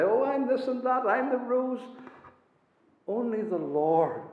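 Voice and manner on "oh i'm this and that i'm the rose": a light higher send-up of a braggart; mock the self-praiser gently; mocking boast. Preacher parodying a boasting man, mildly mocking. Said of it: "No man can praise himself like this".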